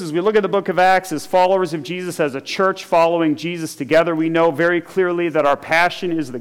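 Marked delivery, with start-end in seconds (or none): none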